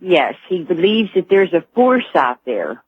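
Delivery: normal talking voice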